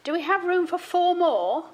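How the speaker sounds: high, loud, bright question down the line; mother on the phone. Retold or imitated. Mother shouting her question from the phone, high and loud. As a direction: ask it loudly so the whole house hears. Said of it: "I'm not giving, do, going an American accent".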